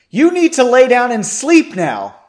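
high, very loud command; yelled order. A narrator's reading. Margot ordering Henry to bed, yelling. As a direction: yell it as a firm order. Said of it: "Margot yelled".

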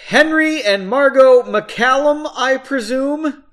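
high, loud and slow formal announcement; too loud announcement. Acted. Court official booming at close range, too loud. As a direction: boom the names out far too loudly. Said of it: "announced in far too loud a voice".

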